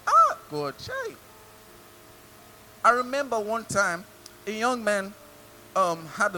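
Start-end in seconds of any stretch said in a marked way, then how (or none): 0.1-1.1 very high-pitched exclamation to God; hammed-up envious wail; mock lament. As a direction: cry out in mock dismay, way up high. Mimics envy of the rich.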